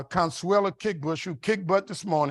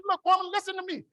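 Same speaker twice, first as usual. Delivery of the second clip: mock exasperated plea; 13 semitones higher than usual. Husband begging wife to hear him out, high mock exasperation. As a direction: voice leaping very high.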